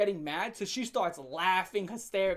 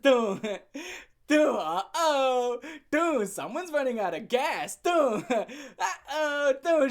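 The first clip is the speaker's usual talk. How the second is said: louder, slow drawn-out teasing; taunting; 5 semitones higher, 5 dB louder, slower than usual. Retold or imitated. Taunting his girlfriend as she runs out of strength, mocking. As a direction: gloat as she tires.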